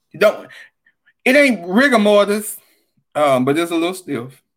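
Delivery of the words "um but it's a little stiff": ordinary talk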